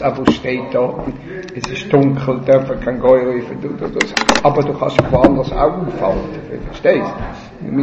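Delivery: normal talking voice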